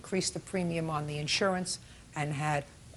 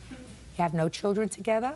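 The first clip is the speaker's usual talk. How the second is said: ordinary talk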